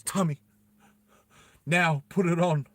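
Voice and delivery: high, tense and pressing; shaky urgent plea. Acted. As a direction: urge him, voice tight with fear. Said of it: "And she's shaking".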